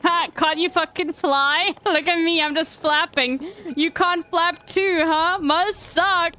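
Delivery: high, drawn-out mocking bird voice; taunting little bird. Little bird mocking the player who can't fly, taunting. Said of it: "He's like".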